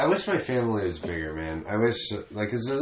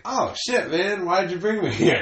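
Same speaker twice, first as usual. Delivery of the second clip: higher-pitched mock protest; retold dismay; 7 semitones higher than usual. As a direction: act out a mildly appalled reaction. Retells his dismayed reaction to mom.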